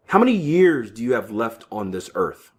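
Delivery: ordinary talk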